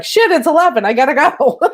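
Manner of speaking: sudden high, loud panicked blurt; jolted into a hurry; surprised. Retold or imitated. To herself, realising the time while watching: mock panic.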